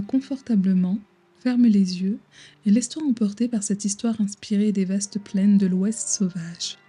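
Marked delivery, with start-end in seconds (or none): none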